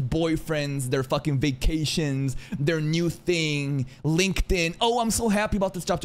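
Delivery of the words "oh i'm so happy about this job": high-pitched mocking job announcement; mock happy poster. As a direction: parody a gushing online brag. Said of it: "people posting pictures of their".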